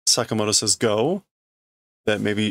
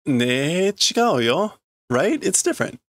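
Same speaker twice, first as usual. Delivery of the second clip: put-on high, insistent little girl protest; cute girl voice; 7 semitones higher than usual. Girl insisting to the doctor in a high voice. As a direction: pipe up much higher, pleading.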